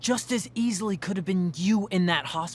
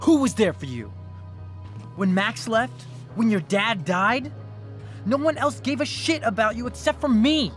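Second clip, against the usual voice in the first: raised voice, pressing each accusing question; angry tirade; 4 semitones higher than usual. Acted. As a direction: build to an indignant, high outburst. Agitated suitor berates her.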